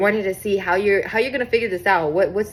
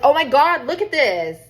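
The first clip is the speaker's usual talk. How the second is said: higher, louder burst of excitement; excited discovery; 8 semitones higher, 6 dB louder than usual. Real, not acted. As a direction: cut yourself off with a thrilled exclamation. Spots a striking card mid-reading.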